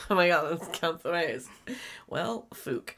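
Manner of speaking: usual speaking voice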